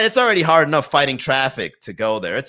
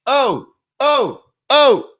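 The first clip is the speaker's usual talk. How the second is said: much higher, rising whoops of "oh"; hype man whooping; 12 semitones higher, 4 dB louder, much slower than usual. Retold or imitated. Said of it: "He's waving it around".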